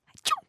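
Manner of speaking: small soft sneeze; a little gnome's sneeze; tiny sneeze. Acted. Gnome waking in the book, sneezing softly. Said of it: "qui venait d'éternuer".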